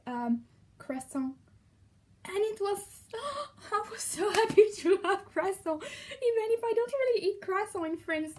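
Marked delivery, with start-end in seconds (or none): 3.1-3.7 sharp high gasp of delight; excited gasp. Retold or imitated. Recalling the croissant surprise. 3.7-8.3 higher, louder, bubbling with happiness; excited joy. Retold or imitated. Retelling joy over croissant.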